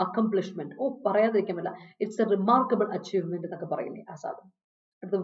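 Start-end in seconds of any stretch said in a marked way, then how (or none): none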